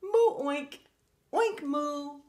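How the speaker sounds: high, loud moo and oink; bouncy high moo-oink duet; happy animal calls. A narrator's reading. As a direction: show off both animal noises. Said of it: "Each of them could moo and oink".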